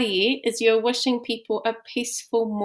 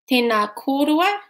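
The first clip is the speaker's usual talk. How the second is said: ordinary delivery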